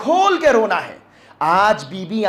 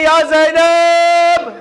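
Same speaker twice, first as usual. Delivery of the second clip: long, high, loud wail; mourning invocation; 11 semitones higher, 7 dB louder, much slower than usual. Shown as a demonstration. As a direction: stretch the call into a drawn-out lament. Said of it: "جب آپ کہتے ہیں".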